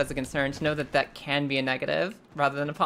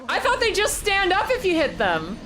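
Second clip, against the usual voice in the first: high-pitched, agitated rush of disbelief; flustered protest; 11 semitones higher, 4 dB louder than usual. Real, not acted. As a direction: protest loudly, still rattled from the scare. Protesting right after the scare.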